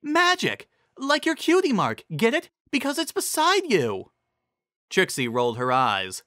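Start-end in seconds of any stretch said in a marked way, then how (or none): 0.0-4.1 high, bouncy, keen; eager guess. A narrator's reading. Cheerilee proudly explains her guess to Ditzy, eager. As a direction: pitch it like a proud discovery.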